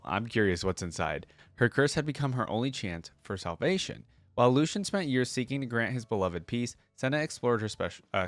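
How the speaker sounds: ordinary talk, nothing marked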